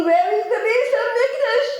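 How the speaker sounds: high, aching plea to heaven; pleading lament for the Temple; yearning cry. Pretend. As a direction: cry out longingly for the lost Temple.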